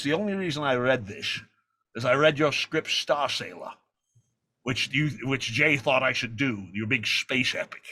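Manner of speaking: slurred-s Scottish actor impression; Sean Connery. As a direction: imitate the film legend, measured and authoritative.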